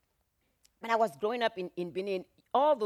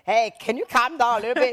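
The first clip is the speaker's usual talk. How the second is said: mock calming; 5 semitones higher, 11 dB louder than usual. Calming the angry singer.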